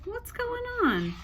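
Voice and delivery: confused little punctuation marks. Retold or imitated. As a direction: squeak out the confusion. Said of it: "I'm like".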